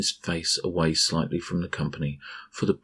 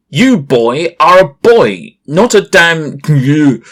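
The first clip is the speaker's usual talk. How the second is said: very loud, higher, slow furious yell; angry shout; 10 semitones higher, 18 dB louder, slower than usual. A narrator's reading. Uncle yells in the princess's face, very loud furious yell. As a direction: scream it into the child's face.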